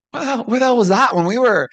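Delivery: half-joking indignant outburst; exasperated. Retold or imitated. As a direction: throw your hands up at the past. Exasperated retold thought.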